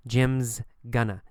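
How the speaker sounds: "Jim's gonna" slow, with contracted "Jim's"; reduced going to. Shown as a demonstration. Teacher modelling normal speech, slow "Jim's gonna". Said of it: "we say".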